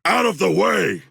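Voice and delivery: ordinary talk, nothing marked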